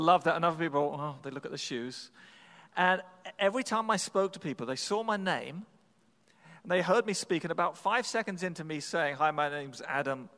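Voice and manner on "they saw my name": ordinary delivery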